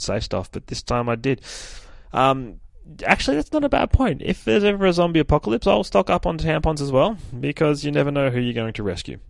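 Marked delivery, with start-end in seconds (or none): 3.0-9.2 sudden enthusiasm. Real, not acted. Mock-serious change of mind.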